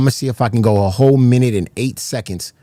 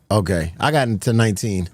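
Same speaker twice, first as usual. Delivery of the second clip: ordinary delivery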